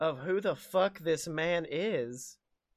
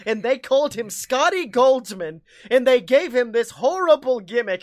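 passionate pitch; 5 semitones higher, 9 dB louder than usual. Retold or imitated.

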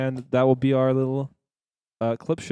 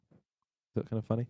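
ordinary talk

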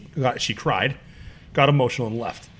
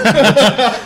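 bursts out laughing loudly; big loud laugh; 9 semitones higher, 9 dB louder than usual. Real, not acted. Host teases about crying.

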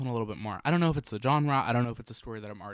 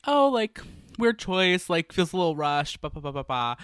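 excited; 7 semitones higher, 7 dB louder than usual. Retold or imitated. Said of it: "I was like".

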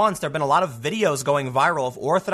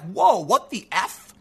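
mildly shocked, pitch swinging up in disbelief; retold shock; 3 semitones higher, slower than usual. Host recalling his first reaction to the story, shocked. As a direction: re-enact a stunned first reaction. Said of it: "I was kinda shocked".

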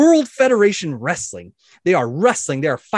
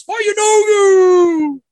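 very high, very loud, long-held war cry; a mob roaring back its battle cry; whole crowd shouting; 9 dB louder, much slower than usual. Acted. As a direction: stretch the chant out at full shout. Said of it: "And everyone shouts".

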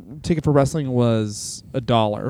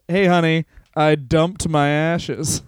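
imitating George; slower than usual. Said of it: "it's Gorgeous George".

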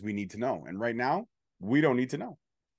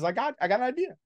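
a light, higher put-on voice for Mister Thomas; mock manager voice pitched up; quoting Mister Thomas; 11 semitones higher, much faster than usual. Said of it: "And Mister Thomas says".

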